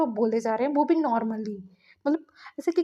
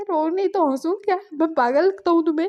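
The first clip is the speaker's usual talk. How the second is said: louder, higher, exasperated shout; angry retort; 5 semitones higher, 5 dB louder than usual. Retold or imitated. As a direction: snap back, fed up and hurt. Boyfriend shouts back during breakup. (झुंझलाकर ऊँची आवाज़ में)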